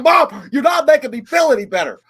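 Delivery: loud, high laughing protest; exasperated shout at mom. Retold or imitated. Teen shouting at mom over a bad haircut, loud and high. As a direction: yell it at mom in disbelief. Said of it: "And mom was like".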